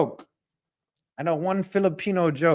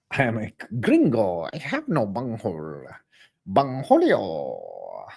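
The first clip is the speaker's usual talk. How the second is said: Cornholio impression; 6 semitones lower than usual. Cornholio catchphrase, acted out.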